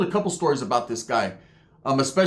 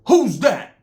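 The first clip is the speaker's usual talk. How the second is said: higher-pitched retort, imitating a loudmouth; mock challenge; 8 semitones higher, 4 dB louder than usual. Loud youngster answers the mellow Uso, challenging. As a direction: fire it back as a retold challenge.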